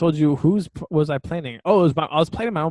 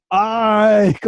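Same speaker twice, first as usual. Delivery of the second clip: loud, high drawn-out name call; exclamation-mark shout; 8 semitones higher, 6 dB louder than usual. Retold or imitated. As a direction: imitate a buddy yelling out in excitement. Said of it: "All these exclamation marks".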